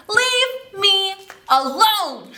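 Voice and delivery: high, loud and slow; a fed-up grandma shouting to chase the bear off; shouted. Acted. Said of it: "the old woman shouted".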